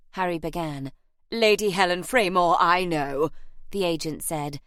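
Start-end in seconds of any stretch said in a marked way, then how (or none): 1.3-3.3 loud, displeased interruption; agent's put-on voice, none too pleased. A narrator's reading. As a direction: cut in, displeased to know this already. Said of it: "sounding none too pleased about it".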